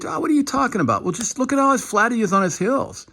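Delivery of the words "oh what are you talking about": high, incredulous mock objection; imagined doubter. Imagined viewer doubting the analysis, high incredulous mock. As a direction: play the sceptical viewer scoffing. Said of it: "Now, you may say".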